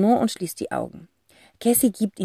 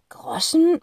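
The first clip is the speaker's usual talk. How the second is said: puzzled murmur; 10 semitones higher than usual. Acted. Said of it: "murmelt Zack und fühlt sich unwohl".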